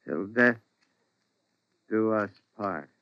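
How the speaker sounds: low, soft, halting repetition; hushed and low with a long pause; brooding echo. Acted. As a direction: echo the vow quietly, to yourself. Husband broods on wife's vow.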